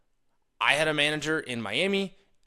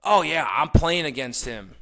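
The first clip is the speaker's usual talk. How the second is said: slow, high, louder eager butting in; eager interruption; 4 semitones higher, 6 dB louder, slower than usual. Retold or imitated. Listener hijacking a chat to talk about himself, eagerly. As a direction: jump in to make it about you. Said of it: "All of a sudden you go".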